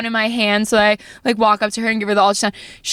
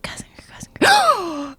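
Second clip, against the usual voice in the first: whispered, dawning realization; hushed mimicry of working it out; imitated puzzled whisper; 10 semitones higher than usual. Dad working out the shirt.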